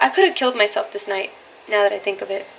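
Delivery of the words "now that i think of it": ordinary delivery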